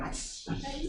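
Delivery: high, soft imitated hushing; mock Korean hush. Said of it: "this Korean sort of way of saying it".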